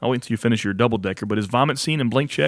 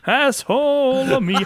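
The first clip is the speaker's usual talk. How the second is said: Jim Carrey quote impression; 11 semitones higher, slower than usual. Quoting Ace Ventura line.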